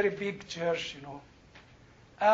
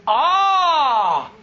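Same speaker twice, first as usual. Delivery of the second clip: loud retort; 5 semitones higher, 7 dB louder than usual. Retold or imitated. Retorting to the doctor.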